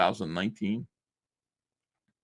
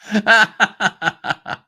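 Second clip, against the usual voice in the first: big high cackling laugh; a sudden loud real laugh; loud burst of laughter; 12 semitones higher, 9 dB louder than usual. Real, not acted. Laughing at a viewer comment.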